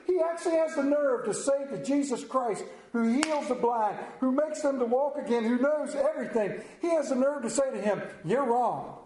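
high-pitched, impassioned disbelief; worked-up, high preacher's exclamation; incredulous preaching. Real, not acted. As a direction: preach it in rising outrage at the nerve of it. Preacher incredulous at Peter's nerve.

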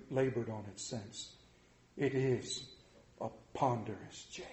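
low ponderous phantom voice; grave, low ghost. Acted. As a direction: drag out the weight of the chain. Ghost continues.

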